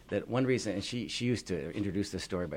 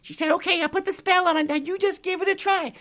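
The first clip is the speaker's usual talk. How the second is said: grateful pixie; 6 semitones higher, 7 dB louder than usual. Acted. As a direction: thank him like a tiny delighted fairy. Pixie figure held voice.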